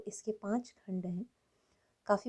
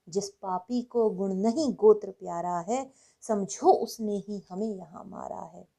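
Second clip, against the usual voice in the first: forceful, louder declamation with punched key words; impassioned poem recitation; 9 dB louder than usual. Acted. Reciting Dinkar's fiery poem to listeners, impassioned declamation. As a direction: recite it as rousing heroic Hindi verse, full of vigour.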